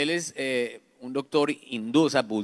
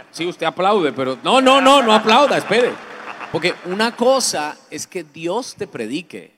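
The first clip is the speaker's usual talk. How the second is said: much louder, higher preacher's cry; preaching at full force; 5 semitones higher, 9 dB louder than usual. Acted. Preacher halting the audience's applause, full-force preaching. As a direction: stop the applause like a fiery preacher.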